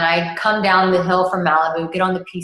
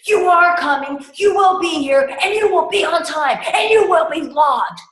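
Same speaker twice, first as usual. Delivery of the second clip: much higher and louder, each line a command; firm commanding shout; 11 semitones higher, 5 dB louder than usual. Retold or imitated. Coach insisting the client shows up, loud commanding. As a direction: lay down the law, then land on love.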